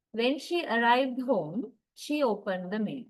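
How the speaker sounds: "when she arrived home" rising, "she opened the mail" falling; rise then fall. Shown as a demonstration. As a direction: lift the clause, then settle. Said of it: "the first tone group gets the rising intonation".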